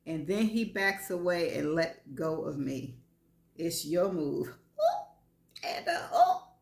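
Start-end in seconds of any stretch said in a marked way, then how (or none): 4.5-6.6 high startled ooh and oh; flustered surprise. A narrator's reading. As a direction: caught off guard, squeak it out. Flustered at his dare.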